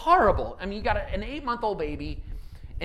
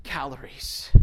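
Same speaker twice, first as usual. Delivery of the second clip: slow, fading to a whisper; hushed appeal; 4 dB quieter, much slower than usual. Acted. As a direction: pull them in close and softly. Preacher drops to hushed close.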